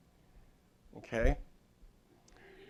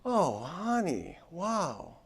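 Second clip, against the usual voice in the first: slow, higher-pitched tender concern; empathetic sympathy; 4 semitones higher, much slower than usual. Acted. Husband listening to his wife's bad feelings, slow empathetic sympathy. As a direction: draw it out with acted, caring sympathy. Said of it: "and just listen and empathize".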